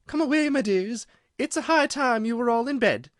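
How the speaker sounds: very high, loud frightened call; canary calling its chicks away in fear; trembling. A narrator's reading. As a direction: hurry the children away in fright. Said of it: "in a trembling voice".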